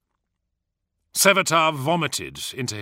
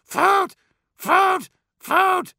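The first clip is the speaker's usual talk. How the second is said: crow calls; 7 dB louder, much slower than usual. Acted. Crows keep calling.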